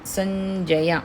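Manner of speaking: సంజయ with the ం as a palatal ఞ nasal, slow and drawn out; ñ sound in sanjaya. Shown as a demonstration. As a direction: stretch సంజయ slowly, nasal ఞ before జ. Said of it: "ఇని sound రావాలి". (సంజయ ని నెమ్మదిగా, ఞ అనునాసికంతో పలకండి)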